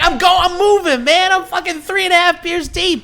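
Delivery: high, excited tipsy exclaiming; giddy. Retold or imitated. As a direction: bounce off the walls, giddy. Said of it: "I'm like".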